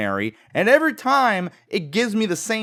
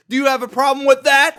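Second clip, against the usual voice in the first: loud, high drill instructor bark; drill sergeant; 8 semitones higher, 6 dB louder, faster than usual. Acted. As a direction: get in the recruit's face. Drill sergeant challenging recruit.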